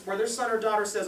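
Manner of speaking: ordinary delivery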